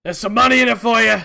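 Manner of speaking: loud, fast sly goblin offer; gravelly sly hobgoblin. Acted. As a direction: tempt her like a shady old goblin. Hobgoblin tempts her.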